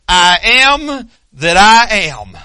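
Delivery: slow, drawn-out divine pronouncement; solemn declaration. Acted. God naming himself to Moses, slow solemn declaration. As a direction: draw each word out with weight.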